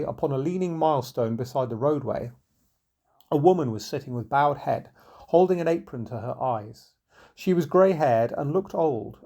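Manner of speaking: plain reading voice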